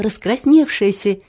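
usual speaking voice

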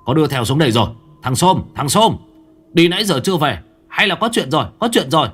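alarmed cry of startled men; panicked. Acted. Startled henchmen shout in panic at the noise. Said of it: "Đám người giật mình hốt hoảng".

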